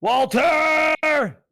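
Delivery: high, full-force scream; screamed challenge. Acted. Rev calls out nemesis Walter in challenge, screaming. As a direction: scream the name out, long and loud. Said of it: "Rev is gonna just scream out".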